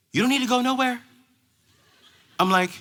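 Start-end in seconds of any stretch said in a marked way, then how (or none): 0.1-1.0 higher-pitched imitated girl's reply; teen girl. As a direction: play a teen girl on the phone. Girl answers on the phone.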